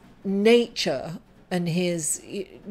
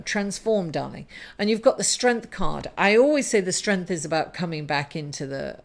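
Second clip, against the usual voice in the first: ordinary talk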